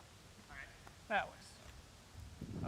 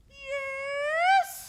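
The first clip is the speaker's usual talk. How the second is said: cartoon princess; 8 semitones higher than usual. Princess answers her father.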